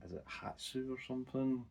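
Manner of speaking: ordinary talk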